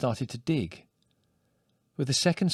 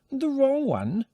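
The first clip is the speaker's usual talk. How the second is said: much higher, rising squeak of surprise; startled repeat; slower than usual. A narrator's reading. Old woman realising her mistake, high startled echo. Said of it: "repeats the question".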